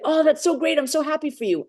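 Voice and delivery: high-pitched, gushing congratulations; delighted reaction. Retold or imitated. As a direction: act thrilled for someone. Acts out reaction to good news.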